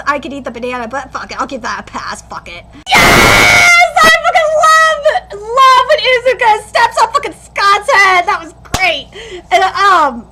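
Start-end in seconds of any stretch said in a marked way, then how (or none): 2.9-8.2 high, loud burst of fan delight; excited cheer. Real, not acted. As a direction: cheer wildly for a favourite scene. Thrilled by a favourite scene.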